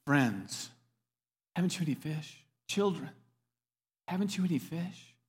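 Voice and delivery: soft, gentle calling; hushed, kind question with pauses; tender call. Acted. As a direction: call them softly, like beloved children. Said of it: "so tender toward us".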